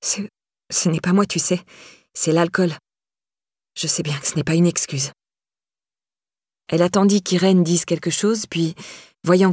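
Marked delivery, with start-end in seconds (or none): 0.0-5.1 stammering. A narrator's reading.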